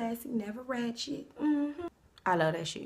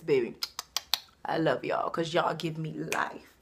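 ordinary talk

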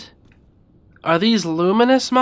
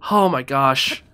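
normal talking voice

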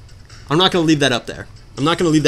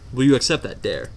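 normal talking voice

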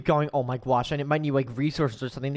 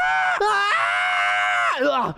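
scream; 13 semitones higher, much slower than usual. Real, not acted. Player tumbling through the portal, screaming. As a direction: yell while dropping into the void.

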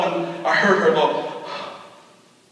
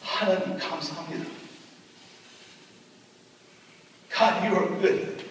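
softer, a mother's hushed thanks to God; quiet thankful prayer; 8 dB quieter, much faster than usual. Retold or imitated. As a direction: murmur the prayer gently, as if you are barely holding on. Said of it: "My mom said".

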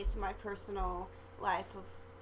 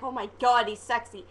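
louder, gushing oh my God; mock swooning; 8 dB louder than usual. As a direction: swoon over him out loud. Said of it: "I was just like".

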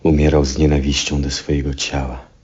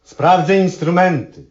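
calling back; 14 semitones higher than usual. Acted. Answers call from afar.

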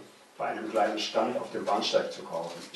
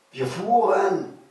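high, loud, drawn-out burst of joy; elated cry; 10 semitones higher, 7 dB louder, much slower than usual. A narrator's reading. Escaped boy feeling the train finally move, elated. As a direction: let the relief burst out slowly.

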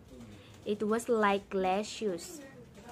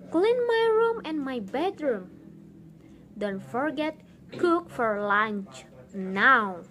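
wicked stepmother; 3 semitones higher, 4 dB louder than usual. Acted. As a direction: order her around like a wicked stepmother. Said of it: "said her stepmother".

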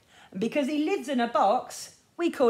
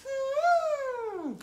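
high hummed trumpet call; elephant trumpet. Shown as a demonstration. Teacher showing elephant noise, high hum. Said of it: "What does an elephant say".

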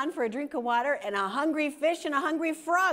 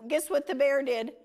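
usual speaking voice

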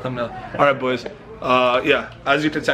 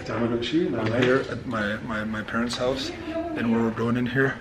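quiet; 2 semitones lower, 7 dB quieter, slower than usual. Real, not acted. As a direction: keep it down, creeping in. Sneaking into parents' house.

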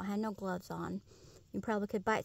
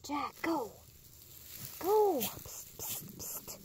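shooing the dog; 4 semitones higher, slower than usual. Real, not acted.

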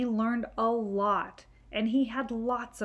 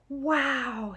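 put-on child in awe; 5 semitones higher, 3 dB louder than usual. Billy's awed child voice.

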